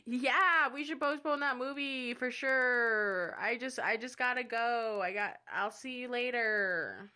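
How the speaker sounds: exaggerated, drawn-out brush-off; over-eager excuse. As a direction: fake agreement while escaping a date. Acting out excuse to flee date.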